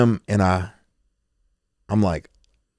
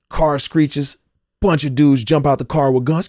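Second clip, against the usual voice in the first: normal talking voice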